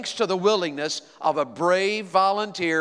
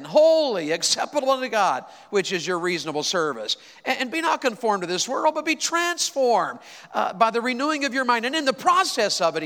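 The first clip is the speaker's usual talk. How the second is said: preaching scripture; 4 semitones higher than usual. Acted. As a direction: lift the scripture like a sermon.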